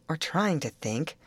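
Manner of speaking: ordinary delivery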